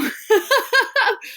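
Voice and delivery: a bright little self-amused laugh that falls away; short high laugh. Real, not acted. Laughing at her own tangled faucet metaphor, a short high laugh. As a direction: laugh at yourself, quick and high.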